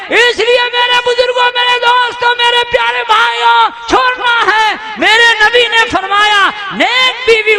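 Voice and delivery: high, loud sermon cry; impassioned preacher shout; full-force preaching. Real, not acted. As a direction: call out to the brothers.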